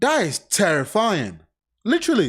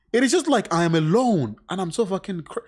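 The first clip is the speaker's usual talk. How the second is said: ordinary talk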